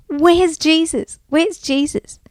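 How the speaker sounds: high, eager calling; excited. A narrator's reading. Bart gets up looking for Jesus, excited. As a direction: search eagerly for someone. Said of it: "Bart was so excited".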